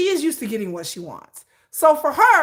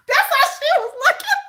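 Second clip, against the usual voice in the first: high laughing voice through words; 13 semitones higher, 4 dB louder than usual. Real, not acted. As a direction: laugh while talking. Teller laughing.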